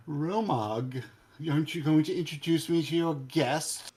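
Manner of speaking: high, loud, indulgent character voice; Hedonism Bot. Acted. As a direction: call out grandly for an introduction. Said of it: "Oh, God, it's hedonism bot".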